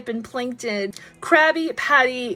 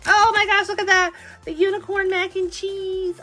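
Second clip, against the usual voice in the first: pitched-up excited exclamation; excited squeal; 6 semitones higher than usual. Real, not acted. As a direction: gasp it out, thrilled by the find. Thrilled by unboxed toy.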